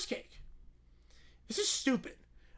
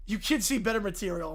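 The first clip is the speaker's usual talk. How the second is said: normal talking voice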